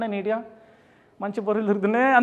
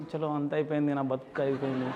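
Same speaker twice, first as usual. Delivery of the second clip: soft, lower, self-pitying lament; mock despair; 7 semitones lower, 10 dB quieter than usual. Boy sent off to hostel, softly lamenting his life is over. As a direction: mourn it quietly, half joking. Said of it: "మస్తు ఏడ్చినాడు నేను".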